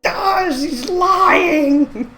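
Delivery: high-pitched, drawn-out bratty wail; hammed-up child protest. Child protesting to mom, acted.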